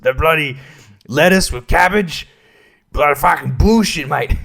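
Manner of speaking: indignant Prime Minister in hammed-up British accent; mock British accent. Comedian resuming the Prime Minister's cabbage complaint, mock British accent. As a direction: grumble about it in a put-on British accent. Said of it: "It's British all of a sudden".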